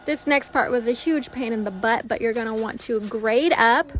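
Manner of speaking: loud upbeat recipe narration; voiceover. Acted. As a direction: read it like a cooking show host. Voiceover continues.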